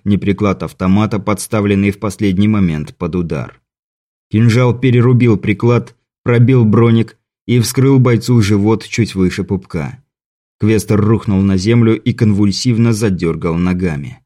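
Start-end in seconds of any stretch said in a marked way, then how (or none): none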